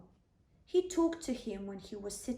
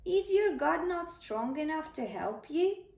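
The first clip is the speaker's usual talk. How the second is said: taunting giant; 5 semitones higher, 4 dB louder than usual. Acted. Goliath taunting.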